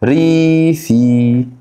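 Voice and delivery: teacher spelling out ريفي slowly; drawn-out ريفي. Shown as a demonstration. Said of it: "يعني كلمه رورال، فاكر؟".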